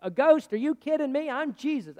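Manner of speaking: high-pitched, indignant disbelief; mock berating. Preacher acting out how Jesus didn't scold the disciples, mock berating. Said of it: "He didn't berate them and say".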